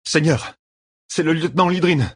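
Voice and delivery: put-on high-pitched, urgent young soldier; breathless young messenger. A narrator's reading. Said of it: "un jeune gars à bout de souffle". (jeune messager affolé, voix haute)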